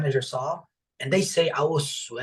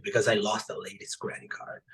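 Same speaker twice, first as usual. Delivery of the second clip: voiceless, breathy admission of guilt; hushed confession; 5 semitones lower, 6 dB quieter than usual. Real, not acted. He drops to a whisper telling podcast hosts about losing the card. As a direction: drop to a near whisper.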